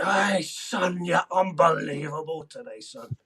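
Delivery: a hint of a Scottish coach's praise; mild Scottish accent cheering the lad on. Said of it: "That was my Scottish accent".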